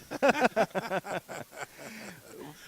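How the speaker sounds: long, high burst of laughter; hearty laughter. Real, not acted. Booth host laughing at a joke about their bodies, hearty laughter. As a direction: crack up for real, trailing off.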